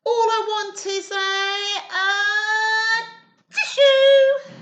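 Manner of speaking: high, loud sing-song wail for a tissue; wailing wolf. Acted. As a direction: howl it like a sad sick wolf.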